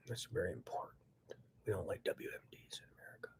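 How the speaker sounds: sudden hushed whisper. Real, not acted. Telling law enforcement, in a whisper. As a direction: drop into a breathy hush. Drops to whisper mid-rant.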